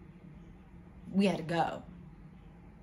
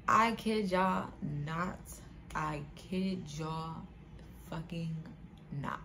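very slow, word by word, dropping softer; slow emphatic vow; 2 semitones lower, 6 dB quieter, slower than usual. Real, not acted. Storyteller to viewers, I kid y'all not, slow deadpan emphasis. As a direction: swear it's true, pausing between words.

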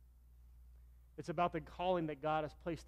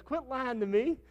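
high, loud mock disbelief; disbelieving friend; 9 semitones higher, 10 dB louder, slower than usual. Laughing friend refuses to believe he's the youth pastor, loud. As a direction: scoff at what you just heard. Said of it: "He goes".

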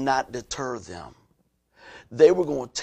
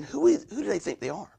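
high, softer imitation of doubters; mock affronted murmuring, pitched up; affronted onlookers; 6 semitones higher, 5 dB quieter than usual. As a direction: question it like a sceptical bystander. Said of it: "as they're looking at this, they're saying".

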